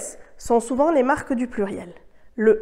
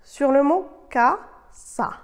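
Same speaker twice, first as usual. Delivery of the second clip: slowly setting up the word casa; drawn-out quiz voice for students; slow, clear classroom prompt; slow exercise prompt; 4 semitones higher, much slower than usual. Shown as a demonstration. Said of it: "Commençons".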